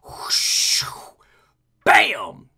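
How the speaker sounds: usual speaking voice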